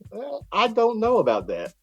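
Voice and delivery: stall, sounding unconvinced; doubtful hedging. Retold or imitated. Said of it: "But if I go".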